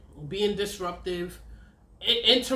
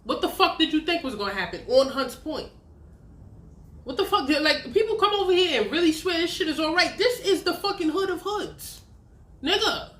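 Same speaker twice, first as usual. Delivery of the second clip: rising, louder exasperated rant; mocking rant in her head, higher and louder; inner mock rant; 8 semitones higher, 4 dB louder than usual. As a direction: let the scorn build as the rant climbs. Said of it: "but in my head, I'm like".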